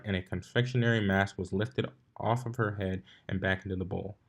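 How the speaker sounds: ordinary delivery, nothing marked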